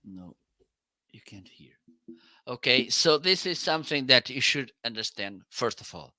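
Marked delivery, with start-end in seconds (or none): 0.0-1.7 barely audible whisper. Shown as a demonstration. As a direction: whisper so the mic misses it. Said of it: "let me stay quiet for a while".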